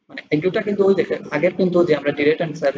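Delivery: normal talking voice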